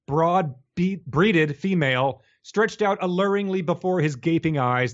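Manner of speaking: grand, overblown narration; dramatic story reading. Acted. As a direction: read it like a pulpy epic. Dramatic reading of fan fiction.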